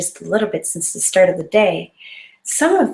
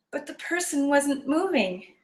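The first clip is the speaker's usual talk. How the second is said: ordinary delivery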